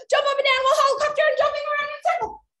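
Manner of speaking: high, very fast tumble of words; rapid-fire command. Pretend. Game leader overloading the players, rapid-fire command. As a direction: rattle off the silly order at top speed.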